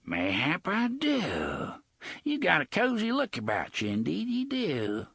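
leering pirate, amused; Gasher the pirate. Acted. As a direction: savour it like a leering cutthroat. Gasher speaks.

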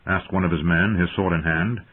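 ordinary delivery

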